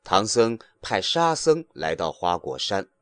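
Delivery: ordinary delivery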